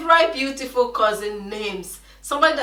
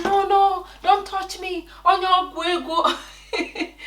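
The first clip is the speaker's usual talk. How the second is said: put-on high-pitched, panicky child's plea; retold panicked scream; 9 semitones higher, slower than usual. Scared child shrieking at her cousin, high and panicky. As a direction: replay a frightened child pulling away. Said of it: "I was screaming".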